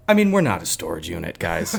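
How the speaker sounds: low, quick practiced line; retold frustration. Frustrated postal worker repeating his practiced line, low imitation. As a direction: drop low for the imitated slam dunk line. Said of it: "you could tell the guy was frustrated".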